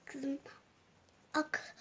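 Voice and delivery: put-on hushed whisper to the girl; breathy whisper. Mother whispers to daughter.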